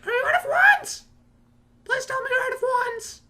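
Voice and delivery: put-on squeaky, very high kid voice; Harry Potter. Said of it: "he's just like".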